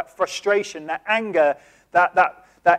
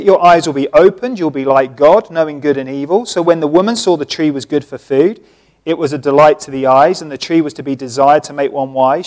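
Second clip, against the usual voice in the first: loud preaching read of scripture; 8 dB louder than usual. Acted. Preacher reading Genesis loudly to congregation. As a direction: read it out to the whole church.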